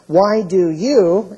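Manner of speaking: "Why do you" drawn out slowly and loud; slow, pointed challenge on "Why do you"; accusing question. Acted. God confronts Eli, reading verse.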